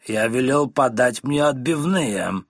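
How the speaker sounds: slow, bossy voice unlike his own; drunk husband. Acted. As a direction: lay down the law over dinner. Drunk husband demanding chops. (медленный властный голос пьяного мужа)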